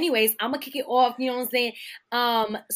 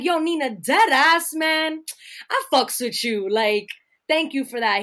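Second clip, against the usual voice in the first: hyped friend quote; 5 semitones higher, 8 dB louder than usual. Retold or imitated. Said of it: "he literally was like".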